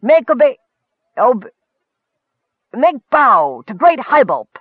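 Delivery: high, loud royal command; regal demand. A narrator's reading. Gorge commanding his subjects to kneel, regally demanding. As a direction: order your subjects with pompous force. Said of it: "Gorge demanded regally".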